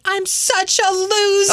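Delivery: high, slow, drawn-out mock lament; mock self-pity. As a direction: wallow in comic self-loathing. Acts out own insecurities, mock self-pity.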